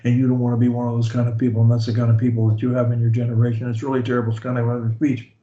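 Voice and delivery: low, fast droning monotone; monotone demo. Shown as a demonstration. Coach mocking a boring speaker, fast low monotone. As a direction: drone on flat and fast like a bore. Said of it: "they talk like this all the time".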